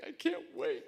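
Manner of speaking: squealed high, eager and bright; high-pitched imitation of an excited woman; bubbly excitement. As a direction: mimic her giddy rush to the cart. Bubbly woman sprinting with cart.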